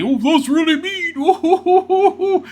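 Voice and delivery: very high, slow mock wail; hammed-up offended crybaby imitation; mocking whine. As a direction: parrot it back in a drawn-out falsetto. Mockingly parrots the interviewer's remark.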